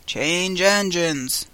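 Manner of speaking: slow, hoarse character voice. A narrator's reading. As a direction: draw it out hoarsely. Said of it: "a hoarse voice spoke next".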